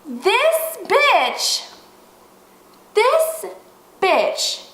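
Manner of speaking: high-pitched, drawn-out indignation; outraged disbelief. Real, not acted. Storyteller recalling the woman's nerve, outraged. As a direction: let each word land, pausing in disbelief. Outraged repetition in heated retelling.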